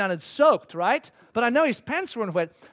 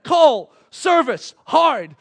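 loud, slow, separated words; acted grudging note-taking; exasperated dictation; 6 semitones higher, 11 dB louder, much slower than usual. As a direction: punch each word as if scrawling it crossly. Dad notes it down crossly.

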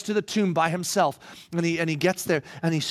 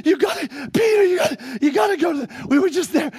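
higher, louder, breathless stammering; women frantically telling Peter to go; frantic; 10 semitones higher, 6 dB louder than usual. Acted. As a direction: tumble over the words urgently. Women rushing to tell Peter.

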